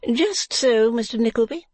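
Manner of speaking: high proprietress voice; Madame Mantalini. Acted. Madame doing business with visitors, high character voice. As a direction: pitch it well up as the madame.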